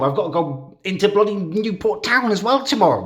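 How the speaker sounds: high, exasperated ranting; venting real frustration at himself; irritated rant. Real, not acted. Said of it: "really irritated with myself".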